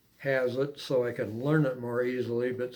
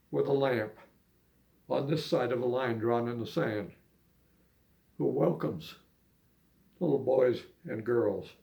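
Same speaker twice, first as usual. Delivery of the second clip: unhurried spoken verse; poem recitation. Acted. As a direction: recite it gently, line by line.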